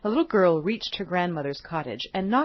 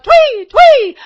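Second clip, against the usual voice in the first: very high, loud chirping call; bird chirp; 17 dB louder than usual. Acted. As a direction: chirp a warning like a robin. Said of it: "who called out".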